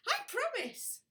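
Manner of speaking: high, sugary fake promise; high sweet promise. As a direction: chirp it innocently. Poppy's false promise.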